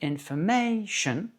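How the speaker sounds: slow "information", lengthen and lift MAY; stress on MAY. Shown as a demonstration. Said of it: "elle est chantée plus aiguë".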